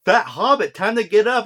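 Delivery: hammed-up rousing shout; mock wake-up call. Female Frodo waking Fat Hobbit, mock loud call. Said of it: "and she's saying".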